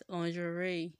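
lingerie modelled as lon-zhuh-RAY; teaching pronunciation. Shown as a demonstration. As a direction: lingerie as "lawn-zhə-ray". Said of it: "Say it after me".

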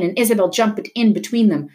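plain reading voice

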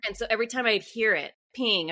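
ordinary talk

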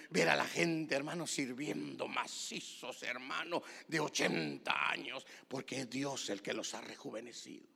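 ordinary talk

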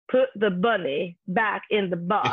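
slow, lightly put-on Southern drawl as Nicolas Cage; a hint of a dopey Southern drawl, slowed right down; mock menacing Southern drawl, done lightly and slowly. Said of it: "this hilarious Southern accent".

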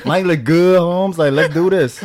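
put-on high, loud East LA Mexican accent. Friend recalls greeting people in his East LA Mexican accent. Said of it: "that East L A accent".

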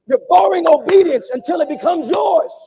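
loud, stressing borrowing; emphatic shouted return; shouted emphatic repeat. Real, not acted.

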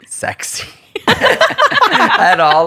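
breaks into a high laugh. Real, not acted. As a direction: laugh through the word, stretched slow. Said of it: "I wouldn't say it's".

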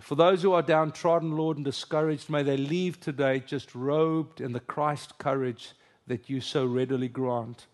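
ordinary delivery

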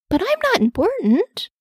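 high, insecure little character voice; puzzled self-doubt; doubtful questioning. Acted. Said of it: "he questioned".

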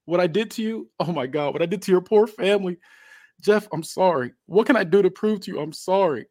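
high-pitched, pleading, acted crying; tearful apology. Wrestler in pain begs Jeff's forgiveness, tearful pleading. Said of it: "he starts crying and says".

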